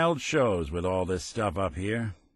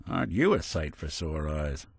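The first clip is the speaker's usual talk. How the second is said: ordinary talk, nothing marked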